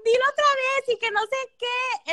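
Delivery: high, loud mock gushing; excited proud dad. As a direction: call the whole family to hear, thrilled. Dad proudly announcing to family.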